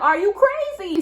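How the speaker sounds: high incredulous question; retold stare-down, are you serious; that look. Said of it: "giving him that look".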